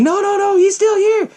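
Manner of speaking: high, loud frantic warning; mock panic. Sidney warning Billy about the killer, loud mock panic. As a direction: blurt it high in acted panic.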